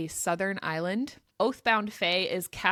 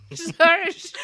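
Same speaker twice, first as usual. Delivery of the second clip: higher, louder laughing slur; laughing drunk slur; 9 semitones higher, 10 dB louder than usual. Shown as a demonstration. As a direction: laugh through a drunken toast. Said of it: "saying Saris's name while drunk".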